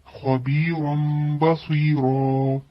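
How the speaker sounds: खबीरम बसीरा very slowly, m joining into b; slow recitation. Shown as a demonstration. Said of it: "खबीरन नहीं पढ़ेंगे". (खबीरम बसीरा बहुत धीरे)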